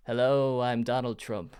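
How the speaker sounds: low, soft, half-hearted impression; a weak go at the president's voice; Donald Trump. Shown as a demonstration. Host trying on request, lightly voiced Trump. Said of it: "Can you do Trump?".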